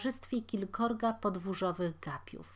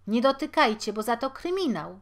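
sharp, loud shout of warning; 4 semitones higher, 8 dB louder than usual. Acted. Bystander warning the crowd not to touch, loud. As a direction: warn them off.

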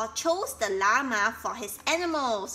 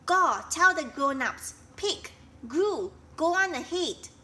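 urgent; 6 semitones higher, 5 dB louder than usual. Acted. Flocky gives urgent orders.

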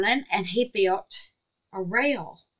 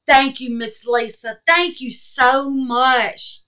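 gushing thanks; 4 semitones higher, 8 dB louder, slower than usual. Real, not acted. Thanking gift giver warmly.

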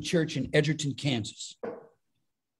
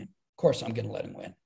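much lower and softer, confiding; quiet aside; 7 semitones lower, 6 dB quieter than usual. Retold or imitated. Comedian repeating the line to the interviewer as a hushed aside. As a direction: drop it to a knowing murmur.

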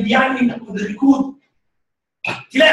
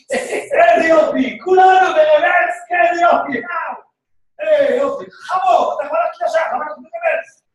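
sarcastic cheering; 5 semitones higher than usual. Pretend. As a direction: cheer with fake delight, then spit out the insult. Sarcastic cheering of reverse movement.

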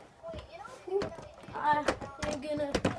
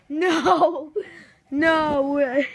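disbelieving; 5 dB louder, much slower than usual. Real, not acted. Disbelief at hitting thirty subs.

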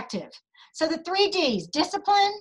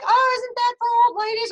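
mocking skeptical customer; 9 semitones higher, 4 dB louder than usual. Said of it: "if they say anything like".

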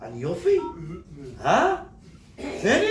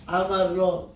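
usual speaking voice

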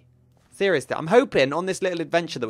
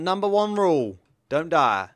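normal talking voice

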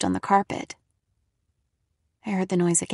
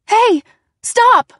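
high, loud panicked shout; frightened cry; 11 semitones higher, 10 dB louder than usual. A narrator's reading. Narrator cries out at a shadowy intruder, terrified. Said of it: "I choked out a cry".

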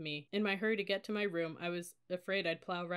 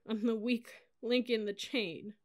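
high, slow tearful wail; drawn-out broken voice; sobbing; 5 semitones higher, 4 dB louder, slower than usual. A narrator's reading. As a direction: confess it while crying. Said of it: "a huge involuntary sob".

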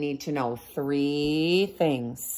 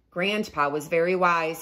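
ordinary delivery

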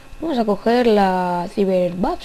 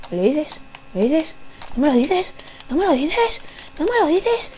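each ask higher, ending much higher; rising nagging; 6 semitones higher than usual. Acted. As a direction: pester more and more insistently. Pestering a passerby, rising. (insistencia cada vez más aguda)

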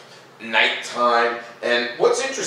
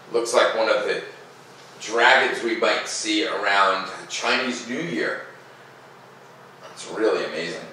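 ordinary delivery, nothing marked

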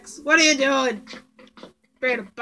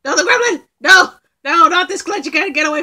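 much higher, loud frantic no; panicked shouting; 5 semitones higher, 11 dB louder than usual. Real, not acted. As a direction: yell in real panic. Panics at gremlin.

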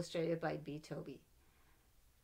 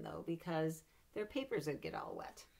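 ordinary delivery, nothing marked

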